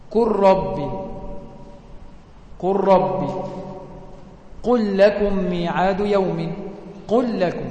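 ordinary talk

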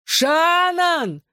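very high, long-drawn call; yelling. A narrator's reading. Boy calling his girl from hospital bed, drawn-out yell. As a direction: holler the name, stretching the first vowel. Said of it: "заорал я во всю мощь лёгких".

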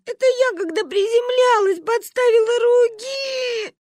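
high wail stretching at the end; sobbing child. A narrator's reading. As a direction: sob out the explanation in a kid's voice. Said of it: "рыдала Маня". (сквозь рыдания, высоко)

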